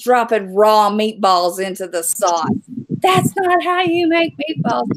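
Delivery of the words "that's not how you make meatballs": higher, prissy mock voice of the critic; mocking imitation. Imitating the critic scolding her meatballs, higher and mocking. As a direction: sneer it like the woman scolding her cooking. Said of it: "she literally went batshit".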